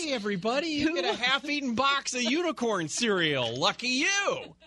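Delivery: high, bright mock game-show host voice; hammed-up cheerful giveaway announcer; mock cheery announcer. Mocking office giveaway announcement.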